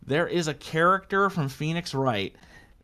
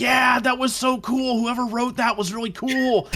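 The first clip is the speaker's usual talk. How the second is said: excited townsperson; 7 semitones higher than usual. Pretend. Voicing townsfolk chatter.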